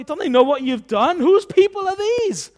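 high, loud mock outrage; indignant angels. Angels coming down to disown the people, high indignant complaint. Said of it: "imagine they said this".

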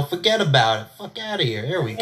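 put-on New York street talk; New York accent. Shown as a demonstration. Host showing how New Yorkers talk, put-on New York accent. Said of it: "We talk like this".

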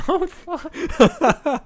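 high, loud laughing shock. Real, not acted. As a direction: blurt it out in shock.

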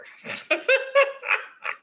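airy giggle; unvoiced high giggle; high breathy laugh. Real, not acted. Laughing at own joke.

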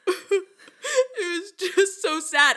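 high, slow laughing mock cry; high laughing fake sob; mock sobbing dude. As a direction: imitate a crying guy. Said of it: "a guy in the back that's like".

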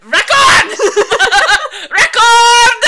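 shouting Record through laughter; laughing shout. Pretend. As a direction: yell it while cracking up.

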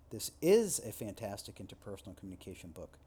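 is punched, falling tune to the end; stress on IS. Shown as a demonstration. Lecturer showing how emphasis shifts meaning, stress on is. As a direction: lean on the word is. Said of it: "just by shifting the emphasis".

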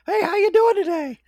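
very high, squeaky cartoon mouse voice; Mickey Mouse voice. Friend mimics Mickey greeting a pal, high squeak. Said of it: "talking like this".

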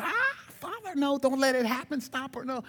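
high, frantic pleading; pleading angels. Retold or imitated. Preacher voicing angels begging the Father, high frantic plea. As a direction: beg the Father to stop her. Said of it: "they were probably going".

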